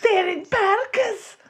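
high, drawn-out screaming in cartoon Scottish; hammed-up Scottish accent, loud and slow. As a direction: scream it like a purposely bad Scot. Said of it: "I'm gonna scream about sharks".

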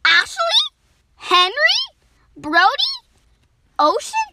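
high, loud rising questions; questioning suggestions. Acted. Animals suggest names for the water, high questioning calls. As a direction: toss out each name with a sharp upward lift.

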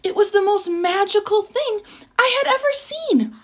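high-pitched wonder; amazed. A narrator's reading. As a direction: marvel at it, voice lifted. Narrator marvels at the magic.

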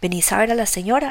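usual speaking voice

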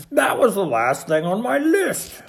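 high, loud excited exclamation; shouting. A narrator's reading. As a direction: call it out excitedly as Toad. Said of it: "shouted Toad".

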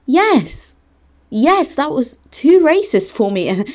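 high, drawn-out emphatic comeback; indignant retort. Retold or imitated. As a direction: answer back high and insistent, stretching the words. Retells own indignant retort.

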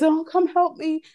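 high, slow whiny plea; mock crying plea. Fallen girl begs her crush for help, mock crying. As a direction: imitate her wailing for help, stretched out. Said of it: "She started crying".

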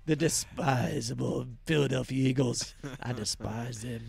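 hammed-up contempt, dropping low and soft and slow on the last words; mock hatred. Sports host fakes hatred for the Eagles, mock contempt. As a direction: sneer out your mock loathing. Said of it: "get all into hatred".

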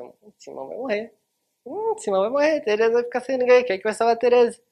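high, loud, rushed fretting; mock worried outcry. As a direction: wail it like a worried audience. Audience worrying, voiced. (lamento exagerado, alto e agudo)

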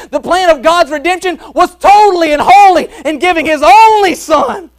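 loud, high, ringing revival preaching; full-force preaching. Acted. Preacher to his congregation on God's gift, full-force preaching. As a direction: preach it out to the back pews, full voice.

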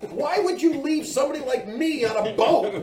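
hammed-up indignant protest; indignant retort. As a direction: argue back in comic outrage, laughter underneath. Retold indignant argument over the boat.

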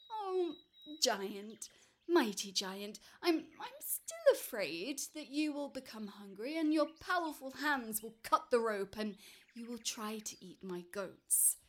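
worried man still afraid of the giant; pleading little man. Acted.

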